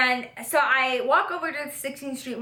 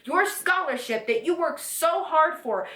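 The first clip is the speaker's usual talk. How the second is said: retold indignation, drawn out and rising high; incredulous, re-enacted scolding; exasperated anger; 3 semitones higher than usual. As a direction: stretch the stressed words in mock outrage. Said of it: "I genuinely felt the feelings of anger".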